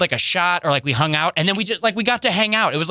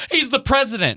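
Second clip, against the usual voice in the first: high bro voice; 9 semitones higher than usual. Retold or imitated. Fan explains.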